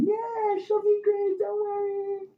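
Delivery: extremely high, squeaky friends chiming in; squealing friends. Retold or imitated. Friends squealing reassurance at the husband, extremely high. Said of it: "all her friends are like".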